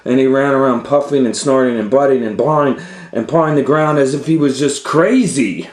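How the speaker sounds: fast, loud, high storytelling climax; excited narration. A narrator's reading. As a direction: rush through the frenzy. Bull rampages after sting.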